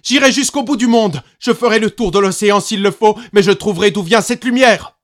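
put-on high, loud, determined child; boy swearing he will go; defiant vow. A narrator's reading. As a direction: vow it fiercely. Boy vows defiantly, running away.

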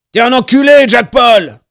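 high, very loud angry bellow; raging, full-voiced shout; furious yell. A narrator's reading. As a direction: burst in yelling abuse. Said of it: "hurla-t-il".

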